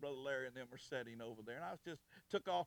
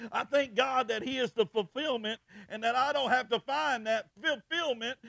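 loud, raised-pitch pulpit oratory; full-force preaching; 6 semitones higher, 12 dB louder than usual. Acted. Preacher rejecting the world's cheap wonder before the congregation, preaching at full force. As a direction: drive each phrase out over the congregation.

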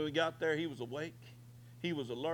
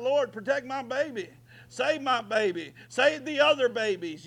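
high, loud cry to the Lord, pleading; shouted prayer; 8 semitones higher, 7 dB louder than usual. Pretend. Preacher crying out to God for the children, loud pleading. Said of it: "You gotta shout it out".

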